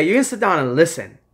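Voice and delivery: commanding sit down and listen; forceful command. Real, not acted. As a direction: tell them firmly to sit down.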